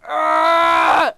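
long, high-pitched loud scream; loud yell. Real, not acted. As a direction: let out a sustained high yell. Startled yell during game.

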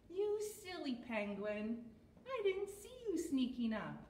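exaggerated storytime surprise at a puppet guest; mock surprise for children. Feigned surprise at penguin puppet's arrival.

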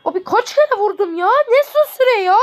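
high, loud outraged complaint; outraged protest. Real, not acted. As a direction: shout the injustice. Süreye isyan ediyor. (öfkeli itiraz)

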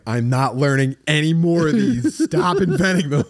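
fed up with new words; mock annoyed early human; exasperated caveman. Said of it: "people were like".

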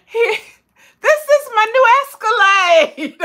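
high, loud teasing laugh; laughing as she presents her walking stick; playful joke. Real, not acted. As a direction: show it off as a joke. Jokingly shows walking stick.

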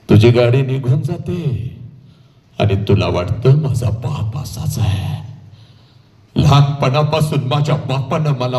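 low delivery with long pauses; solemn dramatic storytelling. Acted. Orator addresses the departed daughter, solemn and low. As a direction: let each phrase fall low and hang. Dramatic hushed turn in oration.

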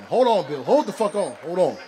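loud, high shout to stop him; shouting at Bill. Real, not acted. Comic yelling at heckler Bill to hold on. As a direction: yell him down mid-set.

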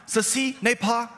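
high, slow non-native French accent; amateur French. Shown as a demonstration. Preacher reads painting's caption to church, amateur French accent. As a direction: attempt French without really speaking it. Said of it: "pardon my French, I don't speak it".

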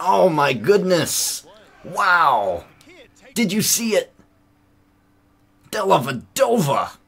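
high, loud amazed cheering; thrilled exclamations. Real, not acted. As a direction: cheer in disbelief at the play. Celebrating the amazing play.

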